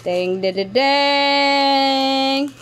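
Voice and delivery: playful drumroll-style reveal jingle; sung "ta-da" fanfare. Pretend. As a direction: sing out a little reveal fanfare. Vocal fanfare before revealing gift.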